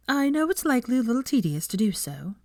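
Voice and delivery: a hint of a friendly young professor; gently smiling introduction; Hermione, smiling. A narrator's reading. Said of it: "still smiling".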